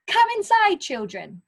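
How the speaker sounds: a high, loud, bossy call; teacher voicing a bossy character calling the children in; bossy command. Shown as a demonstration. Call them in like the boss of the playground. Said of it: "a very bossy little character".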